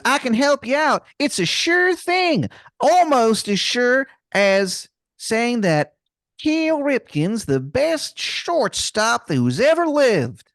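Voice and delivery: put-on very high, drawn-out Maryland accent; Wizard of Baltimore, awful Maryland accent. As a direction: stretch it out in a shamelessly bad Maryland accent. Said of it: "this man's accent is something".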